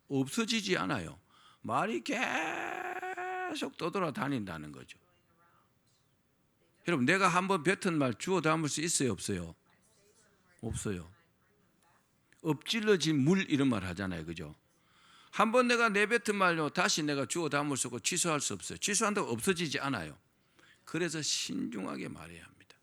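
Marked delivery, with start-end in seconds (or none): 1.6-5.0 drawn-out high line. Real, not acted. Preacher stretches a sustained line. 20.8-22.8 whispered. Shown as a demonstration. Preacher whispering the moral before the congregation repeats it. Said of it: "따라합시다".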